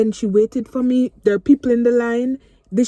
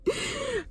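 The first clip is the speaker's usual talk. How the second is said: tearful laugh; 10 dB quieter than usual. Real, not acted. Laughing through tears at checkout.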